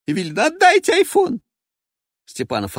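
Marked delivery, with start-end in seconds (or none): 0.5-1.4 high, loud demanding cry; a woman demanding her phone; shrill diva voice. Acted. Evelina voiced.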